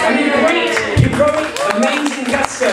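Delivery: high-pitched, gleeful shout of approval; excited cheering. Real, not acted. Cheering the player's attack on guard.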